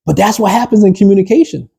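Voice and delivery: normal talking voice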